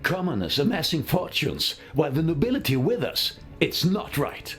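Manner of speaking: high-pitched aristocratic indignation; indignant complaint. Acted. Nobleman grumbling to fellow guests about rich commoners, indignant.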